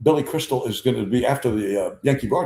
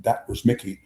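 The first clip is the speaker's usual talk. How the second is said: ordinary delivery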